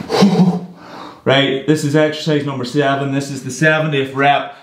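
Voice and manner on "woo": loud cheering whoop; excited whoop. Real, not acted. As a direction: celebrate the finished set. Cheering after finishing a set.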